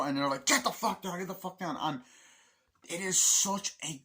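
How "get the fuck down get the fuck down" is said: high-pitched urgent command; heated retold order; acted angry order. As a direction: imitate a soldier ordering people down. Acting soldiers ordering villagers about.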